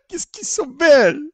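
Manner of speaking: high, exaggerated Italian accent; overdone Italian accent, still laughing; mock Italian accent. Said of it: "comment il a dit là?".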